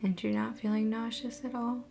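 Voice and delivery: soft, measured meditation voice with long pauses; guided meditation. Acted. Host guiding viewers through a relaxation visualization, guided meditation. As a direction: ease listeners into calm, phrase by phrase.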